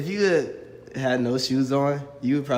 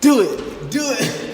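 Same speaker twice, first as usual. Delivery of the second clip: excited shouting; 10 semitones higher, 8 dB louder, slower than usual. Real, not acted. Urging player on.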